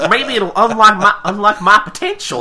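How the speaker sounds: high, loud, laughing mock hope; hammed-up character voice. Host riffing as Applejack, loud laughing character voice. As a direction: blurt it big while cracking up.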